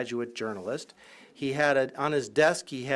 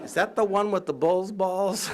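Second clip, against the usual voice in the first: higher mock student voice, laughing; a hint of an eager student, higher and amused; imitating a student; 7 semitones higher, 4 dB louder than usual. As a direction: students gossiping about the professor, higher and laughing. Retold student asking.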